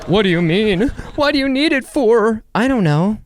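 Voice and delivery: mock alarmed sitcom friend. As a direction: play the friend caught off guard.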